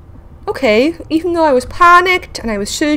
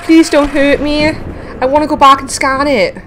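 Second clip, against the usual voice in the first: ordinary delivery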